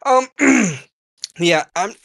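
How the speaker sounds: struggling to talk past a cough; choking voice. Real, not acted. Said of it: "I can't talk".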